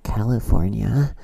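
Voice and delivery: slow, loud caricature with rising end; imitating the friend. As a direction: draw it out in a mocking put-on voice. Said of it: "What is that impression of me?".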